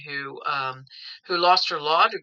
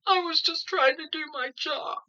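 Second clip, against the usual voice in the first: mock whine; 11 semitones higher, 3 dB quieter than usual. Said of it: "they're going".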